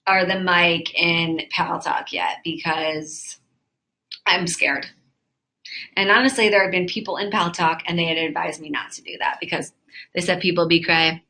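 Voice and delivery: ordinary talk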